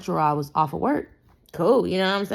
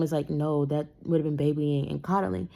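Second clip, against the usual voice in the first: usual speaking voice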